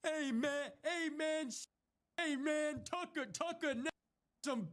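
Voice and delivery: high, soft dopey hey man; mocking dopey caller. Mocking a dim caller stuck on hey man, high and soft. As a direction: play a dim-witted guy fumbling for words.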